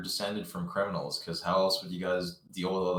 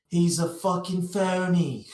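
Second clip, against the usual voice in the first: British bloke; 9 semitones higher, 5 dB louder, slower than usual. Retold or imitated. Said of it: "And then he's like".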